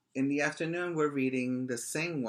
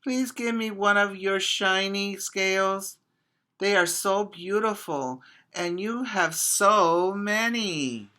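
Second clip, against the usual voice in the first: small fish begging, higher pitched; little fish pleading; 8 semitones higher, 4 dB louder than usual. Acted. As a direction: beg sweetly for a shiny scale. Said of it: "he called".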